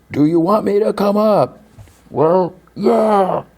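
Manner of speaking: high, sustained shout imitation at modest volume; mock distant calling. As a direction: play both sides calling across a distance. Acted calling up and down cliff.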